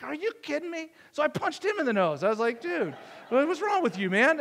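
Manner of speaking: high, incredulous mock protest; mock outrage. Pastor hamming up shock at his doctor friend.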